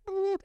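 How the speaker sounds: high, thin imitated censor beep; imitated censor bleep sound; mock bleep. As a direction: squeak it like a TV bleep. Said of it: "it went".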